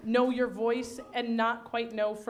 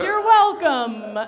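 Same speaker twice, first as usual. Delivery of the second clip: smug you're welcome; 6 semitones higher, 12 dB louder, much slower than usual. Retold or imitated. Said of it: "I was like".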